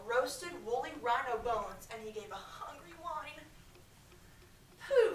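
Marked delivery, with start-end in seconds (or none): 4.8-5.1 high loud scoffing pooh; dismissive pooh. A narrator's reading. Kip scoffing at the begging wolf, high and loud. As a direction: brush the wolf off. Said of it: "said Kip".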